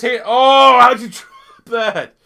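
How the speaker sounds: loud, high cry of disbelief; real exasperated shout at the game; exasperated outburst. Real, not acted. Reacting to a dropped pass.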